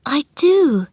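high, mildly tearful answer; close to tears. A narrator's reading. Mary assures Colin she wants it, close to tears. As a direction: answer as if tears are near. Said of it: "feeling as if she might cry".

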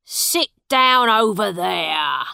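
loud, slow, gruff order; father snapping at his son; angry. A narrator's reading. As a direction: bark it at the boy, slowly. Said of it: "said John Canty angrily".

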